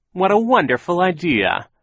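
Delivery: high, loud, put-on enthusiasm; gushing delight. A narrator's reading. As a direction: gush over the idea. Villain gushes over waiter's suggestion.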